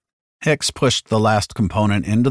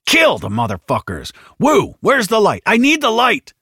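urgent battle shouting, climbing high; frantic commands to the squad; yelling; 4 dB louder than usual. A narrator's reading. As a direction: yell orders over chaos, getting higher and louder. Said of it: "Hicks yelled".

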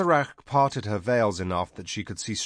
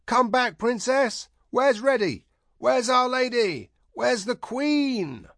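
high, loud calling over a cheering crowd; 4 dB louder, slower than usual. A narrator's reading. Crowd calling after the princess, loud and high. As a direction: call out eagerly to the departing princess.

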